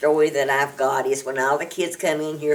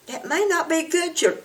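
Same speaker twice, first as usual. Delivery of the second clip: mock prim reply; 12 semitones higher than usual. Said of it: "And I'm like".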